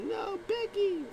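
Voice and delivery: put-on very high, slow child whine; kid fan. Little fan whining at Liv, very high. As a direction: drag it out like a little girl.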